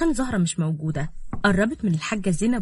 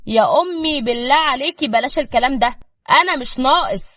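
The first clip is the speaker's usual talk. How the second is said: loud, higher, fed-up plea; exasperated; 5 semitones higher, 8 dB louder than usual. A narrator's reading. Son stopping his mother's complaints, exasperated. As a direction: beg her to stop, out of patience.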